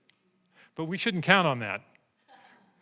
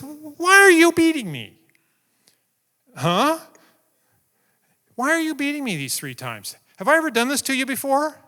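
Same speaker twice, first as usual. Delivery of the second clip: wounded donkey asking why it's beaten, higher and louder; talking donkey; 10 semitones higher, 5 dB louder than usual. Acted. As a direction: give the donkey a high pleading bray of a voice.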